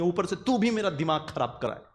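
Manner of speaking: usual speaking voice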